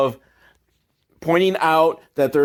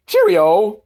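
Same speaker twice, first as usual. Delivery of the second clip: a louder, higher hammed-up British "Cheerio"; mock British Cheerio; 5 semitones higher, 5 dB louder than usual. Podcaster mocks the British kid leaving, hammed-up British accent. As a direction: toss off a hammed-up British farewell. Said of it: "or whatever British people say".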